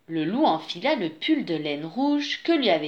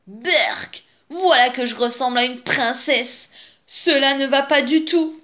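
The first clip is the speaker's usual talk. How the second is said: higher, louder outburst of disgust; disgusted exclamation; 6 semitones higher, 5 dB louder than usual. A narrator's reading. Wolf sees himself as a princess, disgusted exclamation. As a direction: exclaim it, thoroughly put off.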